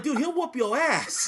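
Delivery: slow, deliberate "he'll whoop your ass"; slow, laughing retold warning; dead-serious warning. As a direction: warn him slowly with a laugh underneath. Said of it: "I said it just like that".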